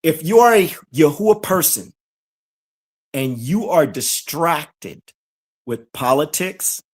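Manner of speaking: slow, low, measured delivery; nice and slow. Real, not acted. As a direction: spell it out slowly for them. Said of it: "let me say this nice and slow".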